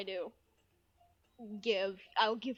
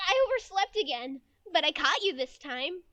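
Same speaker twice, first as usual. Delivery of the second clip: put-on higher, louder girl voice; anime schoolgirl; 7 semitones higher, 8 dB louder than usual. Childhood friend catches up after oversleeping, high anime girl voice.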